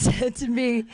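usual speaking voice

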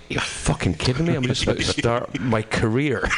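retold outraged disbelief, near laughing; incredulous retort. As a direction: act out being asked the impossible. Acted disbelieving reaction to friend's letter.

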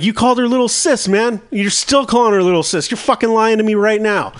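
high-pitched, worked-up quarrel voice; retold indignant accusation. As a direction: act out accusing the brother of lying. Acted quarrel with the brother.

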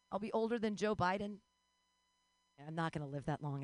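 ordinary delivery

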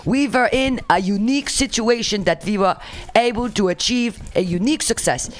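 hammed-up foreign president in a fake Russian accent; bad Russian accent. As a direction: read formally in a badly faked Russian accent. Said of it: "how do I sound like a Russian".

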